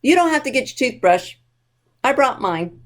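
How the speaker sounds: ordinary delivery, nothing marked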